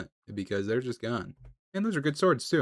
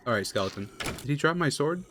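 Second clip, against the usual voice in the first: ordinary talk, nothing marked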